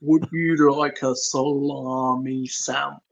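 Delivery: slow, stretched-out theatrical impression; Tom Baker. Podcaster joining the running joke in a slow mock Tom Baker voice. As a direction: draw each word out grandly. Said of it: "go into a Tom Baker impression".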